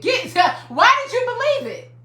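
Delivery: normal talking voice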